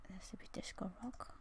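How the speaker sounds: faint breathy whisper. Pretend. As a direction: barely breathe the words. Whispered vote for a song.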